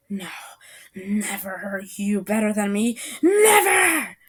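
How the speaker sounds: acted rising outrage; indignant outburst. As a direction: refuse defeat, exploding on the last word. Furious rival refuses defeat.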